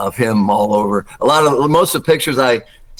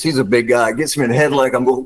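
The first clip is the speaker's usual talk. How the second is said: ordinary talk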